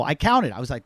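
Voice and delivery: ordinary talk, nothing marked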